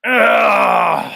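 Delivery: loud groggy groan; groggy waking groan. Retold or imitated. Imitating Paul waking up with a loud groan. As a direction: groan like a man dragged out of sleep. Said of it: "Here's my impression of Paul Enslaved waking up".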